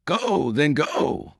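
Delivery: high, drawn-out urging cry; urgent cry. A narrator's reading. Nanan sends the Duke off to the Queen, urgent cry. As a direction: cry it out, stretching each "go". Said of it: "cried Nanan".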